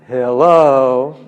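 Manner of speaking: a long rising mock hello; teasing anybody-home hello; sarcastic hello. Shown as a demonstration. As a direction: knock on a blank head. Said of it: "We say this in America, it's kind of a joke".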